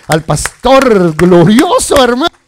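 loud, drawn-out preacher's shout; exultant acclaim. Real, not acted. Preacher rallies applause for the glorious shepherd, exultant shout. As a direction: proclaim it loudly, stretching the words.

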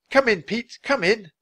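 high, loud calling voice; urgent radio call. Acted.

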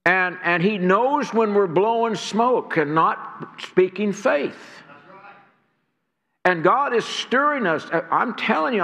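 ordinary talk

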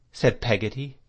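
ordinary delivery

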